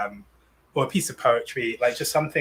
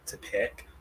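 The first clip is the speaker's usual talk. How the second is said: unvoiced whispered aside; 9 dB quieter than usual. Real, not acted.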